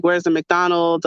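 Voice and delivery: ordinary talk, nothing marked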